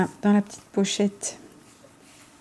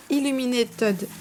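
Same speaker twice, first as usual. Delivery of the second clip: French-accented English, higher pitched; bad English accent; 4 semitones higher, slower than usual. Real, not acted. Vlogger attempting an English deck name, bad French-accented English. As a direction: stumble over Illuminated with a French accent. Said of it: "j'ai vraiment un accent anglais de merde".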